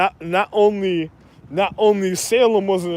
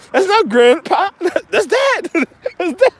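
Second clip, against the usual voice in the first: mock astonished correction; 11 semitones higher, 3 dB louder than usual.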